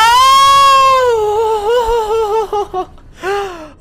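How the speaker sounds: high, loud shouted laugh; shouting laugh. Real, not acted. Caster to viewers after the snipe, shouting laugh. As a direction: laugh out at the reversal.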